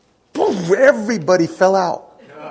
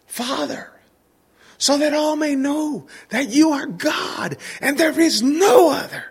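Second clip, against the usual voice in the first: retold prayer yell; 8 semitones higher than usual. Preacher dad cries out to God over a boy, yelling. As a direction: imitate a fervent prayer called out on high. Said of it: "That's what he yelled".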